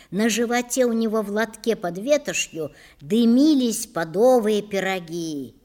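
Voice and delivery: ordinary delivery, nothing marked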